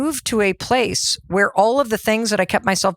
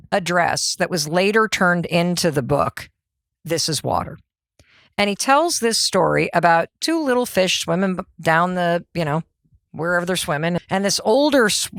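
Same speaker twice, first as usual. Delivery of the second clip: ordinary delivery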